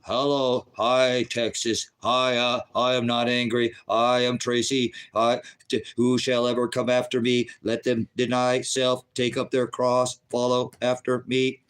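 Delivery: monotone robot voice, every word evenly measured; robot android. Acted. Host showing the android people want him to be, robotic monotone. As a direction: speak like an android on one note. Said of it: "you'll act like a robot".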